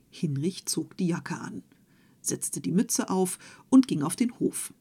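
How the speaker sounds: usual speaking voice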